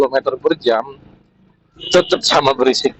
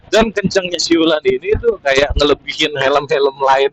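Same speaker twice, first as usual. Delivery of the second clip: usual speaking voice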